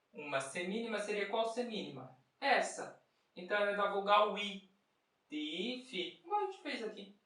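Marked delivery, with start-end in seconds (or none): 5.3-6.2 soft "Ti" stretched, short "fi"; held ti. Shown as a demonstration. Teacher demonstrating the dotted rhythm, held "Ti". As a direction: lengthen "Ti" before "fi". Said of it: "Segura o i um pouquinho".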